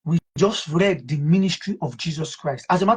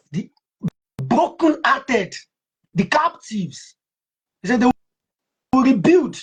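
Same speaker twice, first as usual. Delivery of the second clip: fiery preaching; 4 semitones higher than usual. Real, not acted. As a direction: build up to a shouting peak. Preaching builds to climax.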